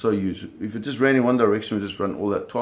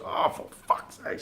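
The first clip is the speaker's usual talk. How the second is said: frustrated curse; 5 dB quieter, slower than usual. Retold or imitated. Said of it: "he was, like, freaking out".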